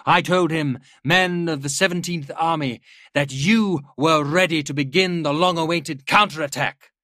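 rally the troops with every phrase; rousing speech. A narrator's reading. General rallying his officers.

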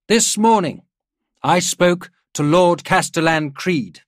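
loud, high-pitched oratory; rousing address. A narrator's reading. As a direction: project it to a whole room of officers. General addressing his assembled officers.

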